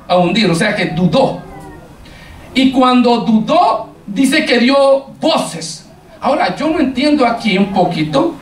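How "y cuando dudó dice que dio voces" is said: slowed emphasis. Acted. Preacher stretching the climax.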